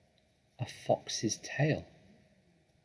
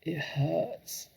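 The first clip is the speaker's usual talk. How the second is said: pained fox; 6 semitones higher than usual. Acted. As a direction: wince through it. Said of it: "said Mister Fox".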